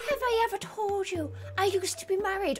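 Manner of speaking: put-on soft old Irish woman voice. Wizard confiding to the player, soft old Irish woman voice. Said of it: "I'm an old Irish woman".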